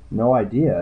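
ordinary delivery, nothing marked

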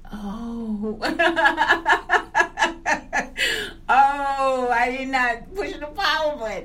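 loud, high laughing fit; real laughter. Real, not acted. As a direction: crack up at yourself, full out. Cracking up at own mistake.

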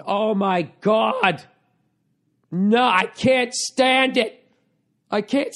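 high, drawn-out mock wail; wife's overwrought reaction. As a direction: imitate an overwrought fan, stretching each word out high. Wife retold as overexcited red-carpet viewer.

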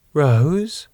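high, rising, tentative call; timid. Acted. Woman peering round a doorway at Rose, timid. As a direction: ask timidly from a distance. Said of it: "A timid voice came from down the hall".

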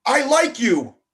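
high, loud bold declaration; loud proclamation. A narrator's reading. Shy boy boldly confessing to his best friend, loud. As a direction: blurt it out with sudden courage. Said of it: "he loudly proclaimed".

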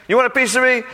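the bully taunt cranked up a notch. Acted. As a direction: push the challenge harder, almost shouting. Preacher voices Goliath taunting.

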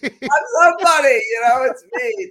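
gleeful high shout after a friend's joke; Steve Martin in The Jerk. Retold or imitated. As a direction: crow it like a giddy fool who just found his name. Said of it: "like Steve Martin in The Jerk".

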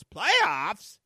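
high, loud, disbelieving coach; stunned press-conference outburst; Jim Mora rant. Retold or imitated. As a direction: bark it out like the incredulous coach. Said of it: "you remember Jim Mora".

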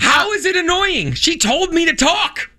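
exasperated, high-pitched objection; indignant protest. Real, not acted. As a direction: protest your innocence, pitch way up. Defending himself in mock quarrel.

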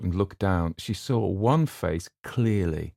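ordinary delivery, nothing marked

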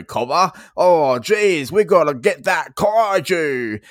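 mock Aussie bloke calling out in broad Australian; broad Australian accent. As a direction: lay on a thick, cartoonish Australian accent. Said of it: "I don't sound like this though".

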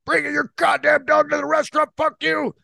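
high mock outrage at dog owners; mock angry Joey rant. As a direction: rant like a disgusted restaurant patron. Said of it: "Joey's like".

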